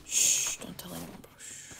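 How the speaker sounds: hushed secretive whisper. Real, not acted. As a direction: keep it to a secret hush. Secret tip, hushing the player.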